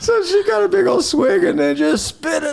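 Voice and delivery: high, loud words through laughter; laughing. Real, not acted. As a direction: laugh while telling the punchline.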